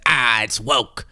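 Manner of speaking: mock Elon. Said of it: "And Elon said".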